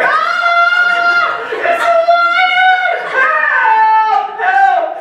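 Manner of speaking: very high-pitched, loud squealing cry for help; hammed-up falsetto scream of a man fleeing a lion; panicked scream. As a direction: shriek it in terror, far above your own voice. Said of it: "so he starts screaming".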